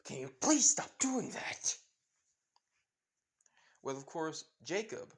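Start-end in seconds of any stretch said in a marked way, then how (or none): 0.1-1.8 high, loud startled yell; loud high shriek at a friend who appears suddenly. Acted. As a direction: jump out of your skin and shout. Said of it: "Fred just jumps saying".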